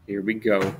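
plain reading voice

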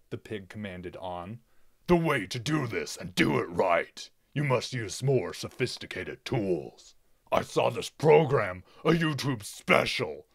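loud, commanding villain voice; bossy pig villain. Acted. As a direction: bark orders at useless henchmen. Pig boss orders henchmen.

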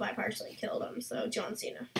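whispered aside. Real, not acted. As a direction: breathe the joke out softly. Muttered joke after a half kill.

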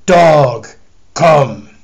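loud, commanding call; stern summons. Acted. The Lord summoning the dog, loud command. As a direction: call the dog like an angry master.

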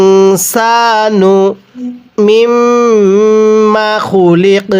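long held vowels, recited tune; slow tajweed reading; slow recitation. Shown as a demonstration. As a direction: chant the joined words slowly. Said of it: "মিলিয়ে পড়ুন".

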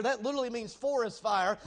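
ordinary delivery

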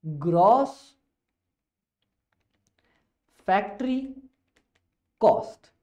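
Gross, factory, cost, each word alone with long pauses between; teacher drawing out the term word by word; fond dramatic reveal. Shown as a demonstration. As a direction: unveil gross factory cost slowly and lovingly, like a prize. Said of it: "प्यार से बोला करते हैं".

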